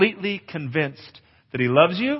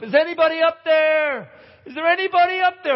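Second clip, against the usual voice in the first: high, louder desperate yell upward; calling up for help; 4 dB louder than usual. Retold or imitated. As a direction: call up the cliff hoping someone hears. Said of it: "And he yells up".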